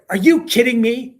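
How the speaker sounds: loud, high retold outburst; really loud. Colleague erupts at broadcaster on the plane, really loud. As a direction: imitate an exasperated colleague erupting. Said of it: "really loud in the plane".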